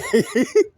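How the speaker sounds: a loud, high, sudden laugh; high burst of laughter. Real, not acted. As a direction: burst out laughing at your own confession. Laughs at own admission.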